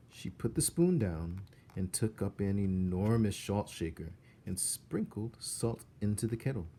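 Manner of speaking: plain reading voice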